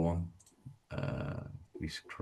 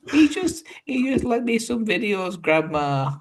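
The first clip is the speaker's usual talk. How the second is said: mock whiny teen; 8 dB louder than usual. Retold boy arguing with grandma.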